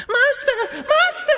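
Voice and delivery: very high, loud falsetto cry; crying harp. A narrator's reading. Magic harp calls to the giant, high squealing cry. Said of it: "when the harp cried".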